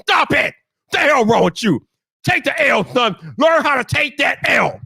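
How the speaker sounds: slow, high, emphatic telling-off; venting scolding. Real, not acted. Streamer tells sore losers to take the L, scolding angrily. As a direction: hammer each phrase in exasperation. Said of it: "So I had to let that out".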